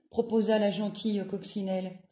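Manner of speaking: usual speaking voice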